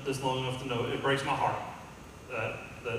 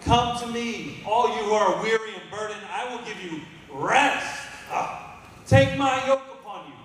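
voicing Jesus, rousing; 8 semitones higher, 6 dB louder than usual. Preacher voicing Jesus to a weary church, loud and high. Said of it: "Jesus says".

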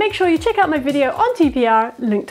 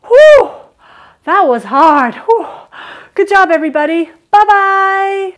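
loud, high, exaggerated whew for the kids; hammed-up excited praise; over-the-top relief; 5 semitones higher, 7 dB louder than usual. Shown as a demonstration. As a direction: blow out a big relieved whew and cheer them. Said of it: "you feel really silly when you're doing it".

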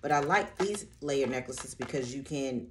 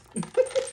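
laugh; 7 dB louder than usual. Real, not acted. Laughs while switching to hats.

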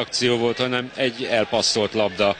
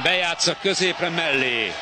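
high shouted near-miss call; sudden burst at the miss; excited commentary; 6 semitones higher than usual. Real, not acted. Near miss in live match.

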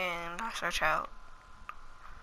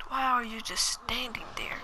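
unvoiced whisper at the sim; hiss-free whisper, annoyed but hushed; whispered gripe; 3 semitones higher, 4 dB quieter than usual. Real, not acted. Muttering at his sim.

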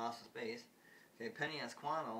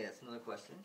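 ordinary talk

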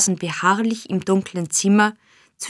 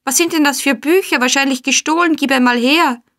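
high, raised, aggressive voice; threatening scolding; 7 semitones higher, 4 dB louder than usual. A narrator's reading. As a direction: threaten and demand, pitched well up. Man threatens and seizes books. (wütend drohend)

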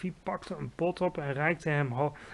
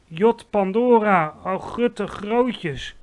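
slow, high, drawn-out exclamation; dismayed cry; 5 semitones higher, 8 dB louder, much slower than usual. A narrator's reading. Giant horrified by his catch, dismayed cry. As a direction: stretch the oath out loudly. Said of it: "riep hij".

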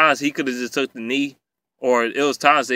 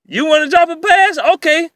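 much higher and louder mocking taunt; sarcastic challenge; 12 semitones higher, 5 dB louder than usual. Real, not acted. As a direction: dare them, dripping with sarcasm. Mocking the receivers who drop passes.

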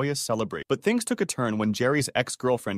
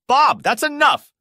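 high, loud, slow rebuke; shouting; 9 dB louder, slower than usual. A narrator's reading. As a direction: cut him off, shouting. Mom stops her brother.

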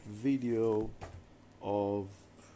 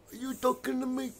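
a punchier, higher De Niro line; Al Pacino impression; 11 semitones higher than usual. Mom doing the taxi-driver line for the camera, mock tough. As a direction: toss the famous line out, lightly voiced. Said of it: "Or Al Pacino".

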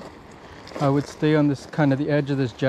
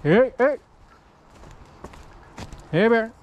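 scaring off a bear; 7 semitones higher than usual. Real, not acted. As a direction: call out to scare off a bear. Calling out to a bear.

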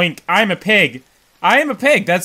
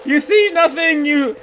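mock taunt; 6 semitones higher, 3 dB louder than usual. Taunting the enemy again.

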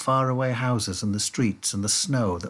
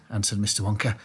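ordinary delivery

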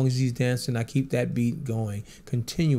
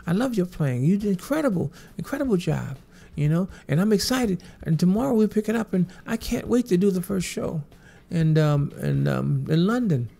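pitched-up Michael impression, thrilled; imitating Michael; 7 semitones higher, 4 dB louder than usual. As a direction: lift the pitch into his voice, gushing. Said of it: "He says".

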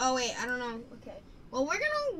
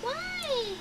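cat voice; 11 dB quieter than usual. Acted. Cooing to her cat.

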